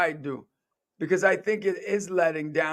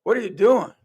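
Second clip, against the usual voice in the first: louder, higher exasperated "what are you doing"; disapproving parent; 4 semitones higher, 6 dB louder than usual. Retold or imitated. Imitating his parents questioning his choices, louder and higher. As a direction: throw the question at him like a baffled parent. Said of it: "I was receiving the opposite".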